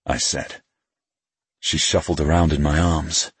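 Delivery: usual speaking voice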